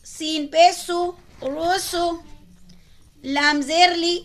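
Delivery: ordinary talk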